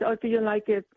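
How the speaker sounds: normal talking voice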